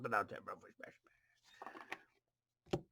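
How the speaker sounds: fast hushed rush of delight; excited whisper. Real, not acted. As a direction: whisper it quickly, thrilled. Excited card reveal, hushed.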